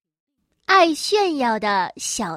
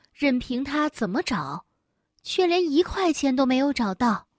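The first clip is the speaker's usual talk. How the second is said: ordinary delivery, nothing marked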